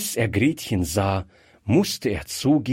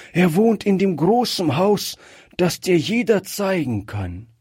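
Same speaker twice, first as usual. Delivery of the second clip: higher-pitched, lightly voiced pleading old man; earnest ailing grandfather; 6 semitones higher than usual. A narrator's reading. Sick grandfather sends Gretchen on an errand, earnest request. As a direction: make an earnest request of the child.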